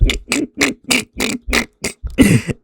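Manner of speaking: high wheezing laugh; real laugh. Real, not acted. Fan laughs at his own Spider impression, real high wheezy laugh. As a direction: crack up at your own joke.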